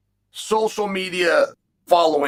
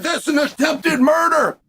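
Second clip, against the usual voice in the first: high, shouted accusation; yelling the word murder; retold yell; 8 semitones higher than usual. Chael quotes Joel yelling at him, shouting accusation. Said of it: "I let Joel yell at me".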